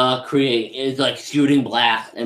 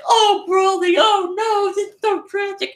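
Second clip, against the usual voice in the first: very high mock wailing lament; Frieza's fake grief. Frieza pretending to mourn for Broly, overacted mock wail. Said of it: "in character acting".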